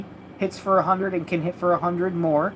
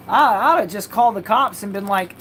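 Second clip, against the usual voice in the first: usual speaking voice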